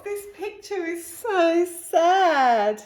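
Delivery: ordinary delivery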